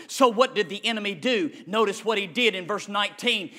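ordinary delivery

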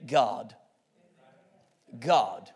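very low, soft single word; hushed answer. Real, not acted. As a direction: say it low and let silence follow.

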